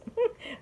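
quick squeaky laugh; a bright little laugh burst; high short giggle. Real, not acted. Laughs at herself.

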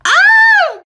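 sudden high, loud shriek of delight; excited high scream. Acted. Spotting her friend's dress, she lets out a loud excited shriek. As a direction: squeal out at full volume.

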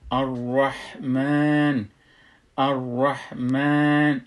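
الرحمن slowly, final ā held long; madd pronunciation. Shown as a demonstration. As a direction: stretch the mā in الرحمن.

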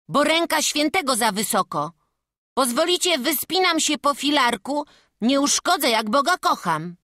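put-on lively boy soothing an anxious elder; carefree boy. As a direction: talk him round as a keen lad.

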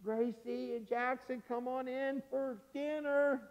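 slow, high, softly drawn-out call; grandma calling out. Acted. Grandma calls grandchildren in from the window, calling out. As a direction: sing it out as if from afar. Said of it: "Grandma sticks her head out the window".

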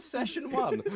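ordinary talk

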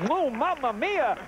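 hammed-up Italian accent, high; a big Italian ooh, mamma mia; mock Italian. Mock Italian over polyester.

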